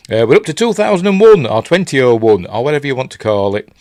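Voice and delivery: talking while chewing gum; words round a wad of gum. Real, not acted. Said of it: "Sorry, I got some gum in my mouth".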